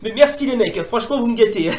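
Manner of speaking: ordinary talk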